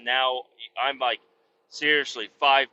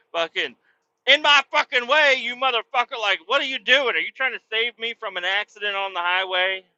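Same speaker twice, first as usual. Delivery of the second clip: ranting at the guy; 4 semitones higher than usual. Retold or imitated. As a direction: build into an incredulous rant. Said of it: "I'd be like".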